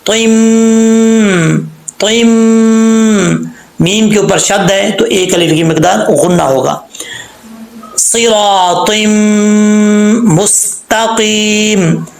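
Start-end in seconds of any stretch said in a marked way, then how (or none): 0.1-3.5 तुम held long with a humming मीम; nasal ghunna. Shown as a demonstration. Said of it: "ता को मीम से connect करेंगे". 8.0-12.1 slow, drawn-out chanted recitation; tajweed recitation; Quranic recitation. Shown as a demonstration. Recites the verse.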